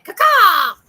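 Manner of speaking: high shrieking crow caw; loud crow caw. Acted. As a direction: screech it like a crow.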